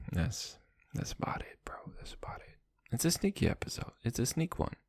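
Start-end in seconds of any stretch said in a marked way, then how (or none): 0.1-2.6 hushed whispered sign-off. Real, not acted. Vlogger signing off the episode in a whisper. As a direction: fade out in a whisper.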